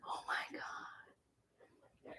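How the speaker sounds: stunned slow whisper; barely voiced, slow and hushed. Real, not acted. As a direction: breathe it out, shocked. Stunned at the chipped tooth.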